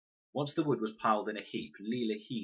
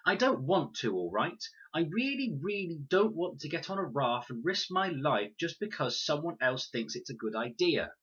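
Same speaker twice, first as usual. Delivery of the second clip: higher, louder, upset; a girl snapping back, rising higher; upset outburst; 8 semitones higher, 4 dB louder than usual. A narrator's reading. Con refusing the raft, higher and louder. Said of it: "Con was going red".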